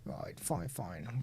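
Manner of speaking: whispered under breath; whispering. Real, not acted. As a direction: mutter it almost silently. Muttering while searching the site.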